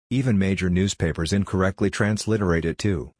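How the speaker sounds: ordinary talk, nothing marked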